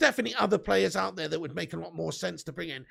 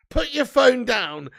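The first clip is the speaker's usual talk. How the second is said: loud, slow, raised-pitch order; mock scolding; 5 semitones higher, 7 dB louder, much slower than usual. Streamer tells off drunk chatter, mock scolding. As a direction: spell out the order slowly and loudly.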